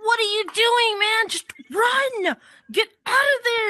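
high, urgent yell at the TV; imitated yelling. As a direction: yell frantically at someone to escape, acting it out. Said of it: "he's yelling his lungs out".

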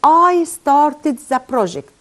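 very slow, clear dictation of the sentence; slow model reading. Shown as a demonstration. Teacher reading the example slowly to students. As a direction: spell it out word by word for the class. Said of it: "أول اكزامبل أهو".

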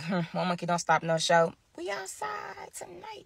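lightly mocking partygoers; mocking the girls. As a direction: imitate the careless friends. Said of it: "'cause they like".

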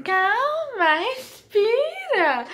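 high, drawn-out mock coaching voice; partner urging calm. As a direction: imitate him stretching out a high "breathe". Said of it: "Mas falando assim". (imitando o marido pedindo calma, agudo e arrastado)

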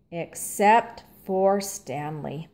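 plain reading voice

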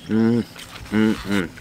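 humming while chewing eggs; closed-mouth mm sounds; mouth full, chewing. Real, not acted. Seen 1: Bite of egg at -1.0; lips closed, chewing through the labelled words.

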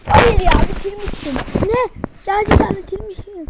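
loud, high stunned yell; surprised shout. Real, not acted. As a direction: shout in happy disbelief. Surprised he finished.

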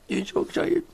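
high, softening coo to the dog; high-pitched pet-talk praise. Real, not acted. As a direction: coo "so excited" up in a squeaky pitch. Baby talk to dog, high take.

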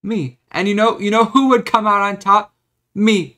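high, loud crowing "Me"; triumphant. Real, not acted. Gloating to viewers about the lawsuit, loud and high. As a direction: crow it out like a winner.

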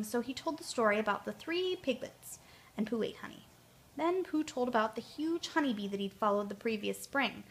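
ordinary delivery, nothing marked

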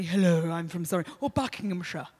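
posh Surrey accent, chest resonance; Surrey accent demo. Shown as a demonstration. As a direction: say it like a proper Surrey local. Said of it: "think of different accents".

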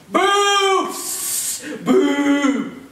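boo him like an angry crowd. Acted. Crowd hating Moses.

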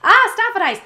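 loud, high outburst at tearing up; real, exasperated near-crying; fighting tears. Real, not acted. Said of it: "I could cry talking about this".

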